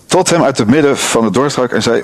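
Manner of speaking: ordinary talk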